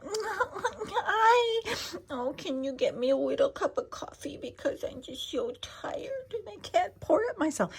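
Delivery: put-on very high, whiny baby-talk voice. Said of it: "when you baby talk to them".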